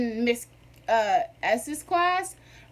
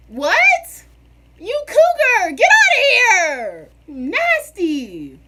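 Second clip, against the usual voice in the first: high, loud yelling with a laugh breaking through; outraged shout; 9 semitones higher, 10 dB louder, much slower than usual. Retold or imitated. Student calling out the teacher for winking, a loud laughing shout. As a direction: shout it out, half laughing in disgust.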